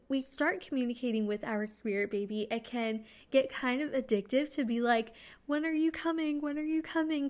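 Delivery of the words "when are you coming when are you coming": high, eager repeated question; excited pestering. Acted. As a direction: act out impatient, excited asking. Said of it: "'Cause we're so excited for that".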